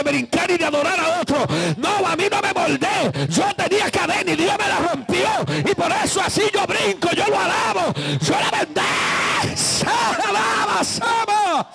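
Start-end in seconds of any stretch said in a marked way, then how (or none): 1.8-11.7 high, straining revival shout; ecstatic shouting. Real, not acted. Preacher defending his freedom to praise, ecstatic shouting. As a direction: cry it out high, building to a peak.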